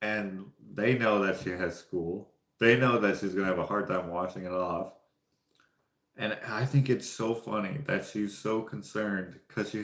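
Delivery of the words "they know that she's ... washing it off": ordinary delivery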